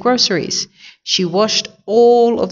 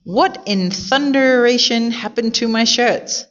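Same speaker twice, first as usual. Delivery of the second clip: slow, higher-pitched storybook outrage; understated roar, stretched and pitched up; mild roar; 6 semitones higher than usual. A narrator's reading. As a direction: demand it with drawn-out indignation, not a full shout. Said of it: "Mister Rogers roared".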